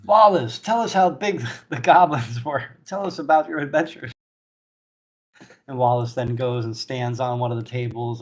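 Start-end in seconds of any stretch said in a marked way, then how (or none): 0.0-4.1 high, loud, booming innkeeper; hearty barkeep. Acted. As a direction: praise the heroes like a jolly host. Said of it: "He's like".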